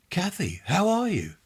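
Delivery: a sister caught off guard by the call; surprised greeting. A narrator's reading. Sister surprised by long-lost call.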